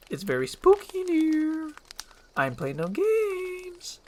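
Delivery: high, drawn-out nervous voice; uneasy high-pitched warning with stretched endings; spooked donkey. Acted. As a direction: creep through the dark, jittery. Donkey nervous in dark windmill.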